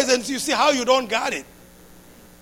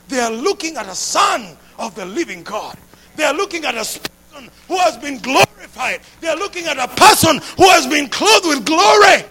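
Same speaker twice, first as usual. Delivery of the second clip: loud, soaring preacher proclamation; impassioned pulpit shout; full-force preaching; 4 semitones higher, 5 dB louder than usual. Acted. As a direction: preach it out to the congregation.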